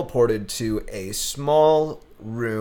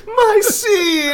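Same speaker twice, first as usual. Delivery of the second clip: very high, loud squeaky critter voice breaking into laughs; tiny outraged gremlin, slow and cracking up; tree dude voice; 6 dB louder than usual. Retold or imitated. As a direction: possessive creature squeal through laughter. Echoing the creature voice.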